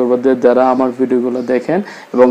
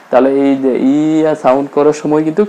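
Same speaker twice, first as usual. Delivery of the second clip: ordinary talk, nothing marked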